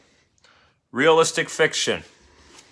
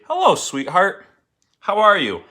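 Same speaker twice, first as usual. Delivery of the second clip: higher, louder, doting grandparent voice; grandparents' greeting; 7 semitones higher, 4 dB louder than usual. Acted. Teacher voicing the grandparents greeting the girl, sweet and higher.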